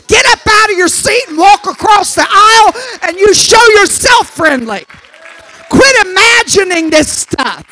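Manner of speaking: loud, urgent preaching; preaching at full force. Acted. Preacher urging churchgoers to cross the aisle, loud and pressing. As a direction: drive the point home to the pews.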